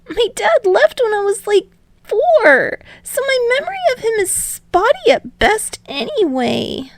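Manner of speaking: put-on high, bewildered young voice; a boy reeling from the news; high-voiced Izuku struggling to take it in; stunned teen boy.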